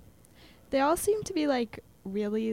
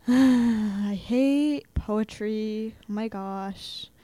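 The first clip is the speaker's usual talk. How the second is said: slow, drawn-out mock groan; sigh, then drag it out loudly; hammed-up student complaint; exasperated classmates; 7 dB louder, much slower than usual. Imitating classmates groaning at poetry.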